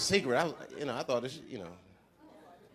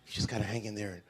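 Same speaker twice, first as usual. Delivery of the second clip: soft, lower condescending comfort; mock advice giver; 10 semitones lower, 13 dB quieter than usual. Show-business friend consoling Dave, lightly mocked soothing. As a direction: patronise him gently. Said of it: "they be saying stupid".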